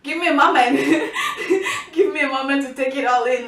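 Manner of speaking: loud plea broken up by laughter; laughing. Retold or imitated. Showing her reaction to seeing father, laughing through it. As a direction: crack up mid-line while acting it out.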